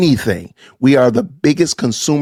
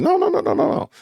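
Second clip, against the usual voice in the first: high, quick little string of no's; mock refusal; 4 dB quieter than usual. Commentator mimics the preacher shutting down empowerment talk, mock refusal. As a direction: pitch it way up and softer, dismissively.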